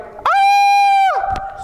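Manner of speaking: put-on high, loud teenybopper squeal; screaming fangirls. Girls at a heartthrob's concert, high screaming. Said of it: "all the girls are like".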